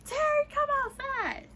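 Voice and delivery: high, drawn-out call; hollering at a door. Retold or imitated. Roommate banging on the door, high loud hollering. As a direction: yell the name up at the house. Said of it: "She bangs on the door".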